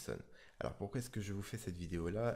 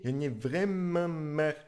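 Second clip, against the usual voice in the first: slow, louder, higher fed-up line; cartoon dad losing patience; Homer getting angry; 5 semitones higher, 5 dB louder, slower than usual. Shown as a demonstration. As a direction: frown and grumble it out. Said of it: "quand je commence vraiment à m'énerver".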